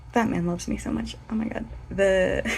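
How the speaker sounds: ordinary delivery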